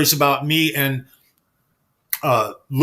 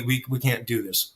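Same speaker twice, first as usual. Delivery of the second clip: calm; 4 semitones lower, 7 dB quieter than usual. Retold or imitated. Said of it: "very calmly".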